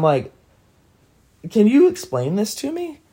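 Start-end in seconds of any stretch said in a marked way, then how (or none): 1.5-3.0 higher-pitched, bewildered retold question; baffled question. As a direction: ask it high and confused, wanting answers. Confused question in retold dream.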